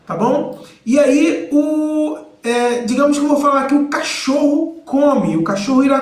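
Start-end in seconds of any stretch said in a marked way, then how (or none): none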